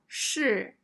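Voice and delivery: 是 with a falling fourth tone; 是 said shì, falling. Shown as a demonstration. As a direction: drop 是 sharply. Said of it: "The first character is fourth tone".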